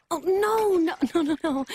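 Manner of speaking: high, laughing protests of no; flustered laughing denial. Acted. Pyra to the old woman, flustered laughing denial.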